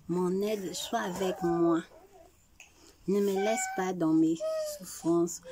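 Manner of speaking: ordinary delivery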